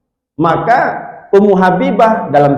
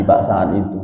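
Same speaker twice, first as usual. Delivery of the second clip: ordinary talk, nothing marked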